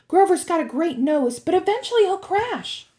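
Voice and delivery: high, loud, hurried warning; high-pitched, insistent girl; urgent young girl. A narrator's reading. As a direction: press the danger on a friend. Annabeth urges Percy on.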